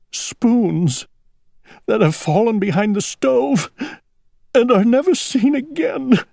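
plaintive, rising toward tears; starting to cry. A narrator's reading. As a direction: let the sadness climb into a weepy pitch. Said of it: "Owl began to cry".

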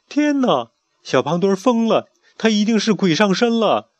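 high, loud shocked cry; alarmed exclamation. Acted. Berio seeing the chick go mad, alarmed cry. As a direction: gasp out that he's possessed. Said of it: "贝里奥惊呼着说".

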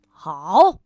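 loud scornful snap; gruff grump scoffs at a greeting; grumpy retort. Acted. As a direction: spit it back in disgust. Grumpy man snaps back.

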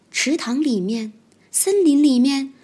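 ordinary delivery

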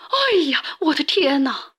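high, frightened yelp; startled old woman's cry. A narrator's reading. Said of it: "瘦小的老妇人喊了起来".

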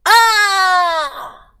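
long high piercing scream; death cry. Acted. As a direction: scream as if dying. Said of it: "一声惨叫划破了这个美妙的夜晚".

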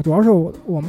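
ordinary delivery

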